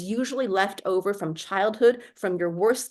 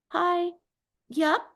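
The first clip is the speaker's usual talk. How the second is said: higher, slow, hesitant self-introduction; shy timid candidate; 6 semitones higher, slower than usual. Shown as a demonstration. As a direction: shrink yourself like a nervous applicant. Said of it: "see how the whole demeanor is different".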